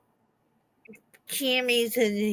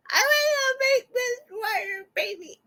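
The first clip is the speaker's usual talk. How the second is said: put-on high wailing kid voice; mock crying kid. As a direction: imitate a crying child, high.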